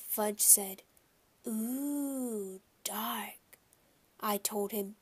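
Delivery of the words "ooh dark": put-on hushed little-kid ooh fading to a whisper; a small child awed by the dark. A narrator's reading. Toddler to his brother as lights dim, hushed.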